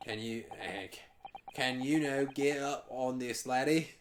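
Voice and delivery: put-on broad Scottish accent, a bit mumbled. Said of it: "Sorry, I can't understand your accent".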